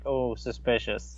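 hammed-up distrust, quiet and slow; mock suspicion. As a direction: play suspicion, dropping low and drawing it out. Acts out wary gift recipient.

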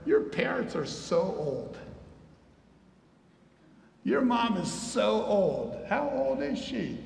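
drawn-out playground teasing; slow, hammed-up jeering; mocking taunt. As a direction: imitate a child making fun, stretching the words. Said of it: "he was laughing at him".

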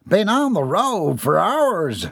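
high, drawn-out country holler; cheerful call. A narrator's reading. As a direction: sing it out cheerfully to the hosts. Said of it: "Jeb called cheerfully".

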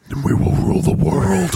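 mock demonic rasp, plotting world domination; possessed child. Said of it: "How did your head spin around?".

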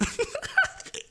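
shrill burst of laughter; giggling squeal; high squealing laugh. Real, not acted. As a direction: crack up at yourself.

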